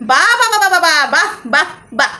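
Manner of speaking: a run of mock-admiring exclamations, falling away; sarcastic bravo. Real, not acted. Vlogger snubbed on the phone, sarcastically praising Dishani. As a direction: applaud the snub with heavy irony.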